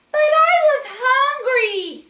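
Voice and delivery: put-on high, loud pleading little boy; a boy justifying eating dog food; whiny child. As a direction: plead like a hungry kid. David's excuse voiced.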